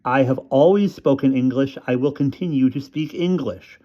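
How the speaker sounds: put-on boy answering his mother, disgusted. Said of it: "in a very disgusted voice".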